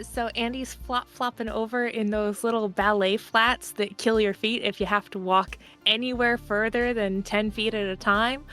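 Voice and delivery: usual speaking voice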